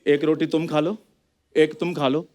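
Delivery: low, subdued motherly voice; lightly voiced mother, resigned and low; self-sacrificing mother. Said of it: "माँ कहती है".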